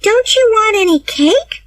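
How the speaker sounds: put-on high, sad girlish plea; sad cry. A narrator's reading. Anne to Diana, high sad plea. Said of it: "cried Anne sadly".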